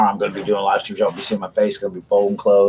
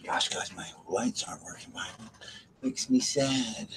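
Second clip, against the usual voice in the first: whispering; 14 dB quieter than usual. Real, not acted. Lights out, whispering in dark.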